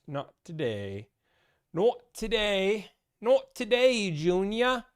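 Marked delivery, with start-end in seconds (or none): 1.7-4.1 higher, louder British accent. Shown as a demonstration. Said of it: "Don't know why I did a British accent". 4.1-4.8 put-on slow, higher British accent, louder; a put-on British accent for a punchline. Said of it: "Don't know why I did a British accent".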